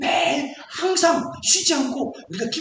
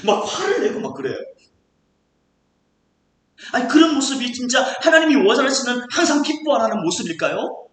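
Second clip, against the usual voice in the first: ordinary delivery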